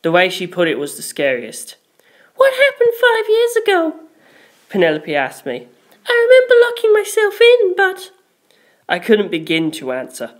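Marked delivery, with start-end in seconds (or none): 2.4-4.0 put-on much higher, light girl's voice; shy, apologetic girl; Penelope's voice. A narrator's reading. 6.1-8.1 put-on much higher, light girl's voice; shy, apologetic girl; Penelope's voice. A narrator's reading.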